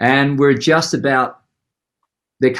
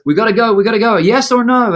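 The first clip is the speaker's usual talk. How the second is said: higher, louder hurried demand; pushy stage manager; 9 semitones higher, 5 dB louder, faster than usual. Retold or imitated. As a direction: rush the musician, yes or no. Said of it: "and he goes".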